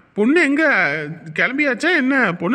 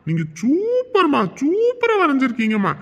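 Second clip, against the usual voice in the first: teasing flattery; 5 semitones higher than usual. Acted. Son flatters mother's drawing.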